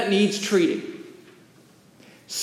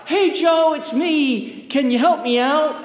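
high, mildly raised hail; acted calling out; 6 semitones higher than usual. Man in a hole hailing his friend, mock call.

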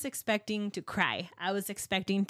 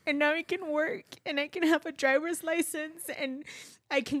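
real welling tears, a bit higher, voice on the edge; tearful; 4 semitones higher than usual. Real, not acted. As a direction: let it crack as you relive it. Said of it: "I cry every time I tell this story".

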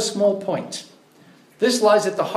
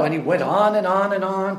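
ordinary talk, nothing marked

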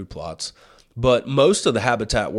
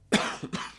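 rough cough; quick hacking cough; smoker's cough; 5 dB quieter than usual. Real, not acted. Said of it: "Excuse me, smoker's cough".

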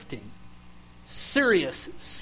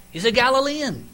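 higher, louder, pointed accusation; emphatic accusation; 9 semitones higher, 8 dB louder than usual. Acted. Bystander accusing Peter by his accent, loud and pointed. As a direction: nail him with "Galilean" said high and loud. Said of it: "You can tell what area you're from by your accent".

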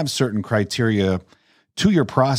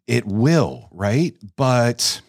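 usual speaking voice

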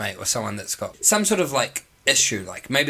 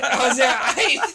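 much higher, louder words broken by laughter; laughing; 13 semitones higher, 4 dB louder than usual. Real, not acted. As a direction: laugh it out in disbelief. Repeating through laughter.

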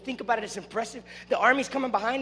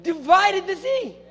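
high, drawn-out exclamation of awe; fired-up preaching; 6 semitones higher, 4 dB louder, slower than usual. Real, not acted. Preacher marvelling at the parted sea, exclaiming. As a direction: stretch the big words out with force.

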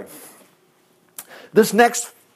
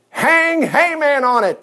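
commanding order; 7 semitones higher, slower than usual. Acted. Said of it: "the king ordered".

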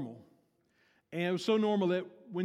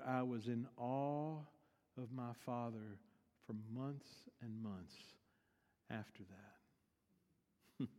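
hushed awe; 5 semitones lower, 11 dB quieter than usual. Retold or imitated. Daughter recalls awe of her father, hushed. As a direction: let it fade almost to nothing. Awed ending of story, hushed.

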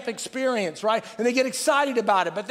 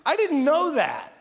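defensive excuse; 5 semitones higher than usual. Retold or imitated. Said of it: "and you say".

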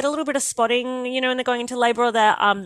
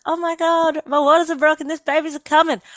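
high, bright-eyed rush of joy; retold excited squeal; 7 semitones higher, 4 dB louder than usual. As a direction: squeal that the baby is coming. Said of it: "And I was so excited".

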